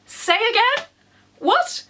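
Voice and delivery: high, squeaky double take; shocked disbelief. Real, not acted. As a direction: jump way up in pitch in disbelief. Shocked by the ghost news.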